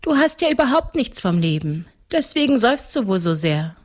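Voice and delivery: a hint of a young girl; little girl. A narrator's reading. Reader voices the little girl.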